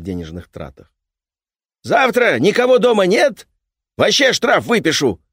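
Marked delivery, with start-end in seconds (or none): 1.8-5.2 loud, high threatening workman. Acted. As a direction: threaten like a pushy repairman. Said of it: "пригрозил незнакомец".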